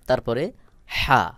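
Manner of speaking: usual speaking voice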